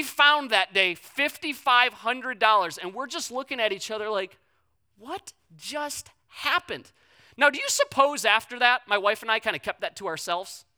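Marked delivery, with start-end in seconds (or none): 5.0-6.9 high, soft, very slow words; stunned disbelief. Retold or imitated. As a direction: act dumbstruck, one word at a time. Couple staring in shock, retold.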